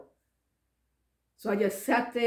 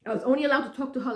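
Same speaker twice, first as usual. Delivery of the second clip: ordinary talk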